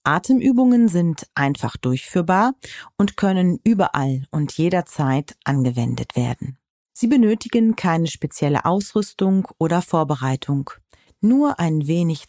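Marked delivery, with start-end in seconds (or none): none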